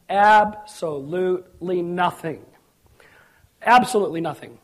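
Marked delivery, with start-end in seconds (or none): 0.1-2.4 drawn-out, deliberate answer; slow and emphatic. Real, not acted. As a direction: stretch "Absolutely" syllable by syllable. Answers own question, stretched first.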